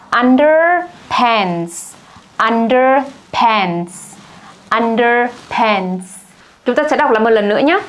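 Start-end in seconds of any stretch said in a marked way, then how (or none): 0.1-6.0 underpants spelt out very slowly; very slow demo. Shown as a demonstration. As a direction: drag each syllable of underpants. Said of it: "slow vocabulary demo". (đọc rất chậm từ underpants)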